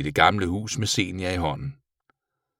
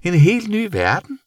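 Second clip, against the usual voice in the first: very high, wondering creature voice; surprised bat; 13 semitones higher, 4 dB louder than usual. A narrator's reading. Bat seeing colours for the first time, high amazement. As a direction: gasp out the discovery, high-pitched. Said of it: "gentog Senior overrasket".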